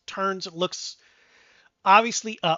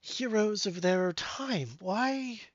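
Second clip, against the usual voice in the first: lightly voiced tall stranger, bewildered; tall one; 3 semitones higher, 5 dB quieter than usual. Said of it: "the tall one".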